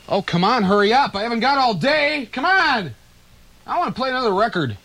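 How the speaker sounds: high, fast, hammed-up impatience; mock impatient.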